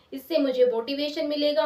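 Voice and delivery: plain reading voice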